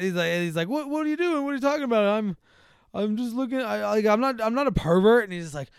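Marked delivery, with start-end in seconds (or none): 0.7-5.2 high, stammering self-defence; imitated flustered denial; flustered protest. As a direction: act out being wrongly accused. Accused man protests innocence.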